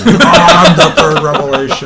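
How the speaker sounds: slow, loud grandiose declaration; hammy mock villain. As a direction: proclaim it like a cartoon villain. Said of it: "echoing the quote".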